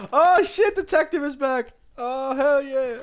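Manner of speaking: high, loud glee at the return; thrilled shout of delight; delighted whoop. Real, not acted. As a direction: cheer the outfit coming back. Delighted the detective outfit returned.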